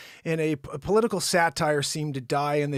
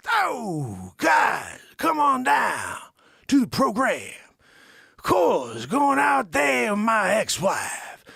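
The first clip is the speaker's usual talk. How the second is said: loud, slow Pacino shout; 7 semitones higher, 5 dB louder, slower than usual. Pretend. Host doing Pacino inviting people to the show, loud. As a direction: bellow it like Pacino. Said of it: "doing Scarface".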